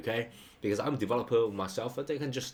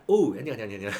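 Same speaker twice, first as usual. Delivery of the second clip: effusive repeated greeting; 6 dB louder, much faster than usual. Acted. Acting out an informal welcome, effusive quick repeated hello. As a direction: burst in with oh, then rattle 你好 into a laugh. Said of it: "that means that".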